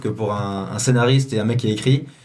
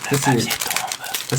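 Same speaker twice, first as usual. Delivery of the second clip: slow breathy whisper for the mic; ASMR whisper; 2 semitones higher, much slower than usual. Pretend. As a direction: whisper it right in your ear. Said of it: "en A S M R, s'il te plaît".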